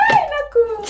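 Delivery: high squealing "Ay, naku"; delighted squeal. Real, not acted. Delighted by husband's gift. (masayang tili)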